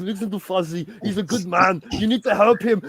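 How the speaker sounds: loud Arnold plea, building; high, building to a shout; Arnold impression. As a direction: plead the cause like an action hero. Arnold impression, pleading for likes.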